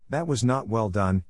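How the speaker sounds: ordinary delivery, nothing marked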